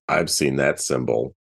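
ordinary talk

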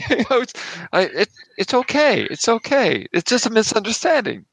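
high, placating mock German accent; smooth it over, stammering in fake German; bad German accent. Immigrant asked not to get hostile, bad German accent. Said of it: "the worst German accent ever".

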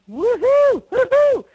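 very high, loud hooting; drunk owl imitation. Brother retells the drunk brother's owl hoots, loud. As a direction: hoot like a tipsy man doing an owl. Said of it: "this owl imitation of".

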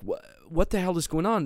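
held-back yell. Retold or imitated. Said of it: "I'm not gonna yell now".

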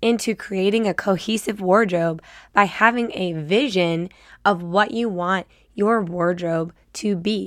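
usual speaking voice

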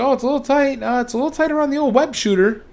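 high-pitched put-on voice; awkward complainer. Peter says the stealth suit is tight, awkwardly high-pitched. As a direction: squirm through it in a high imitation voice.

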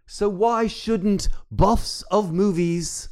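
put-on grand old-English herald voice; Shakespearean town crier. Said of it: "I'm dropping the Shakespearean tone".

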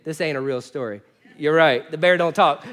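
normal talking voice

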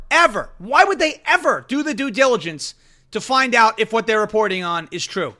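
normal talking voice

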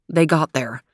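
ordinary delivery

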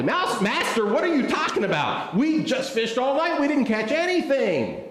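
high, exasperated objection; loud protest. Acted. Fisherman to Jesus after a fruitless night, loudly protesting. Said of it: "he probably said it pretty loudly".

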